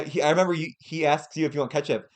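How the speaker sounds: ordinary talk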